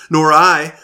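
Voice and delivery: loud, high refusal; firm refusal. A narrator's reading. A second cave dweller refuses the fire, loud refusal. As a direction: refuse flatly and loudly. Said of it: "agreed a second".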